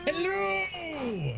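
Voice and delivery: goofy hello yelled from afar; jolly dopey shout. Acted. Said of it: "the echo of a jolly voice".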